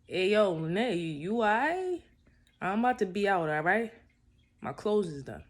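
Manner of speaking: loud, high call to wake her. Retold or imitated. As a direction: raise the voice to wake someone. Waking her, calling out.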